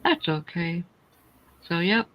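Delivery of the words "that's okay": ordinary talk, nothing marked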